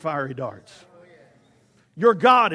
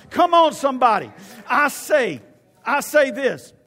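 loud, raised exhorting shout; preacher yelling; 5 semitones higher, 7 dB louder than usual. Real, not acted. Preacher calling on the congregation to respond, yelling. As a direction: rouse the room with a yell. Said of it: "I know I'm yelling".

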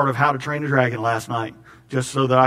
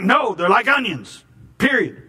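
higher, firm, final insistence; Shrek insisting; 7 semitones higher than usual. Retold or imitated. Preacher as Shrek insisting to Donkey, higher and firm. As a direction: shut the argument down. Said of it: "He's like".

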